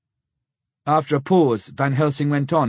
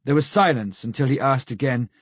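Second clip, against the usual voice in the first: plain reading voice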